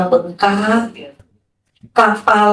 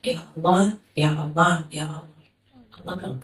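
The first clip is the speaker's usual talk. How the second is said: softer, fading plea to God; lirih memanggil; 6 semitones lower, 8 dB quieter, slower than usual. Retold or imitated. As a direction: call out gently, fading away. Hamba memanggil Allah lirih. (memanggil lirih semakin pelan)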